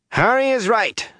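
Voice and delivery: high-pitched call over a crowd; indignant woman's shout. A narrator's reading. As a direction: call it out as you push through. Days calls out over crowd.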